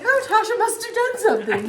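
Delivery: teasing commenters. Retold or imitated. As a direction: tease as if a nosy viewer. Quoting viewers' comments.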